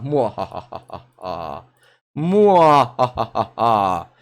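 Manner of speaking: slow villainous mwahaha cackle; mad scientist laugh. Pretend. Evil plan to play the Saga.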